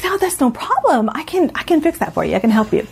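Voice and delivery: high, chirpy customer service voice; imitating a cheerful phone support woman; mock chirpy agent. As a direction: play the upbeat phone agent. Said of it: "And she's like".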